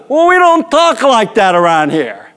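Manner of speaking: high, scolding umpire voice; mock yelling. Umpire scolds the pitcher, mock-angry. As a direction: imitate an umpire laying down the rules. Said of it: "we're yelling".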